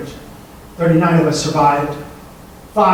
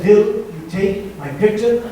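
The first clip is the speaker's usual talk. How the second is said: put-on slow, higher-pitched Eastern European accent; 4 semitones higher, slower than usual. Woman asks him for a picture, Eastern European accent. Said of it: "in Eastern European accent".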